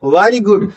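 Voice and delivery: "very good" starting with rounded lips, as "vaari good"; wrong W for V. Shown as a demonstration. Teacher showing the mistake, "very good" as "wary good". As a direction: swap the V of "very good" for W plus "aa". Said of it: "wrong pronunciation है".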